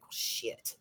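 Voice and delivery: a soft whispered curse; whispered dismay. Retold or imitated. Realizing she has no job left, a whispered curse. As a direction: breathe it out as a private groan. Retold dismay at losing work.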